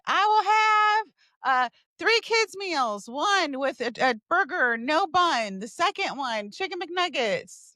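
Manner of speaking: high, insistent ordering voice; acted repeated order. Acted repeat of drive-through order.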